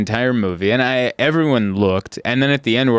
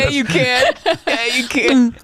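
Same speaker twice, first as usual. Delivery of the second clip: laughing coax; 12 semitones higher, slower than usual. Real, not acted. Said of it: "I can't do it again".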